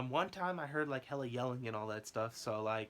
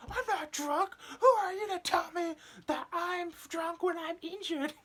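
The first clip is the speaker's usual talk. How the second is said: much higher squealing indignant protest; outraged injured guy; 4 dB louder than usual. Retold or imitated. To the coworker, mock high squealing outrage. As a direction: shriek your innocence in a silly put-on voice. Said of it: "the guy immediately said".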